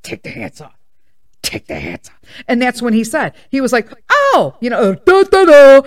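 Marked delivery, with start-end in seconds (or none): 0.0-2.2 quiet urgent signal, mouthing across the room; hushed, pointed instruction; stage-whisper a reminder across the room; mouthed hint. Retold or imitated. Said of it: "I'm doing the hat thing". 4.1-5.8 high, loud sudden realization; loud surprised da da da; startled oh. Retold or imitated. Father realizing, exclaiming.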